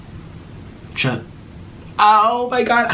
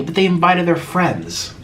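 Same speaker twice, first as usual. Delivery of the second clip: usual speaking voice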